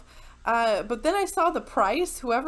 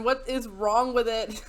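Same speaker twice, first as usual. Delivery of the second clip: normal talking voice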